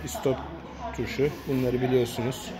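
ordinary talk

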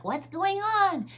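put-on high, drawn-out curious child; kids asking. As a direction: voice the puzzled young kids. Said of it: "and the kids are like".

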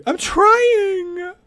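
high frantic cry; panicked shout. Real, not acted. Chased, shouting back.

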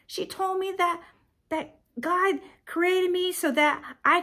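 high, emphatic mock revelation; son's grateful realization. Son looking back on mom's advice, exclaiming realization. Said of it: "you're gonna look back and you're like".

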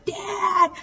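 very high crying call; boy crying for his father; raw scared cry. A narrator's reading. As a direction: cry out in panic. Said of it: "raw and scared".

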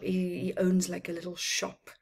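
plain reading voice

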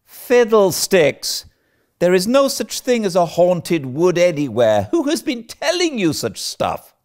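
scoff, then demand who is responsible; scornful scolding. Acted. Marilla scoffing at Anne's haunted wood, indignant.

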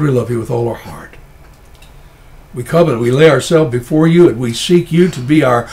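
ordinary talk

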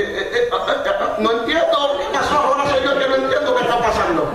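high, stammering, laughing bewilderment; flustered confusion. Retold or imitated. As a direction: stumble over the words, high and lost, laughing. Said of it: "yo le dije". (balbuceo agudo y confundido entre risas)

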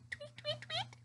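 high, soft chirping tweets; sweet high birdie tweet. Shown as a demonstration. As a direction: chirp like a little bird. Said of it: "can you tweet like a bird?".

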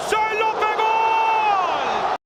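long drawn-out goal scream; screaming football commentator. Real, not acted. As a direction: stretch the goal call slowly. Goal call in live commentary.

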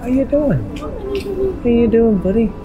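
ordinary talk, nothing marked

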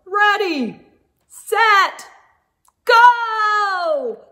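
building, high and loud starter call; race start shout. Acted. The judge starting the race, shouting go. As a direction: launch the racers off the line.

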